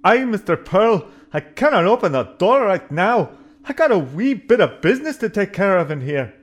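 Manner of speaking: hammy Scottish engineer brogue; broad Scots accent, stubborn. Acted. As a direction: play the ship's Scottish engineer.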